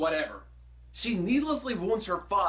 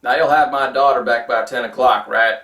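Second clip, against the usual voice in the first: stern dad; 5 semitones lower, 11 dB louder than usual. Pretend. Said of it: "that is exactly how I would greet her dates".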